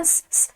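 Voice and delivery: hissed "sss" for hush; imitated shushing. Everyone silencing the doubter about Nicholas, imitated shushing. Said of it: "الان همه بهش گفتن".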